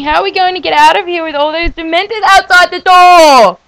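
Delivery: high, loud anxious Hermione. Acted. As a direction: read Hermione's line with drama. Reading Hermione's line aloud.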